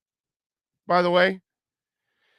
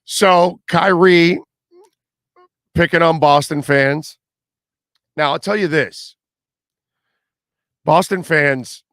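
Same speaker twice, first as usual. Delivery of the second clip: suddenly much louder, hammering each point; loud emphatic; 2 semitones higher, 9 dB louder than usual. Real, not acted. Radio host to listeners about Kyrie, loud and emphatic. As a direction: raise the voice and drive it home to the listeners.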